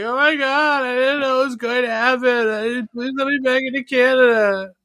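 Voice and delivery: high, whiny mock sobbing plea; mock teary begging to be let back in; snotty tearful pleas. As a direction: whimper it like a stranded brat begging to come home. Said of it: "their snotty, tearful, uh, pleas".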